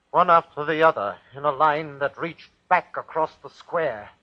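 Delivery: a hangman's voice rising toward a frantic, high cry; rising terror. Acted. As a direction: let the horror climb with every step. Terror as the dead climb the scaffold.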